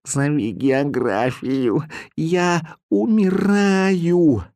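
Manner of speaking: very slow, drawn-out feeble plea; dying cat. A narrator's reading. Dying cat begs owner to call geography, slow drawn-out plea. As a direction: plead as if dying. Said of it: "голос Кузи".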